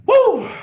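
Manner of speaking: high, loud whoop of hype; excited whoop. Real, not acted. Coach hyping up his team with a loud whoop. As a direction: let out a big celebratory woo. Hyped shout mid-pep talk.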